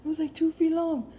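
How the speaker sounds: frantic shouted disbelief; panicked boyfriend. Retold or imitated. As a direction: blurt it out, still shaken by the bug. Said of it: "he said".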